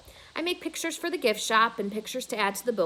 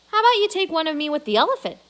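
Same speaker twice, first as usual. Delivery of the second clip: high, loud and fast; eager suggestion; 7 semitones higher, 7 dB louder, much faster than usual. A narrator's reading. Boss angling for a photo with the elephant, eager and fast. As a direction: push the idea eagerly. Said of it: "Mac suggests".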